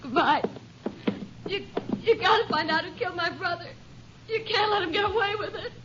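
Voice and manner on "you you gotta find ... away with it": high, soft, crying plea; choked pleading, higher and softer; tearful plea. Acted. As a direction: beg through tears. Sister pleads after brother's murder.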